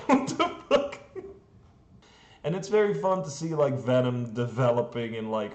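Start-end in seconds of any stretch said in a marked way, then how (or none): 0.1-1.0 laughing disbelief. Retold or imitated. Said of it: "And it's like".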